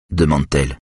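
ordinary delivery

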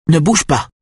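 sharp, high-pitched angry order; rugissement de colère. A narrator's reading. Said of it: "rugit-elle". (rugissement aigu et autoritaire)

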